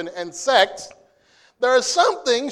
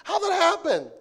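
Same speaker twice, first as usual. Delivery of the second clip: surprised; 6 semitones higher, slower than usual. Retold or imitated. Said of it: "he goes".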